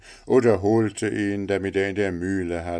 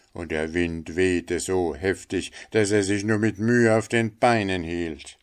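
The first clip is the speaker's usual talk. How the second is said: ordinary delivery, nothing marked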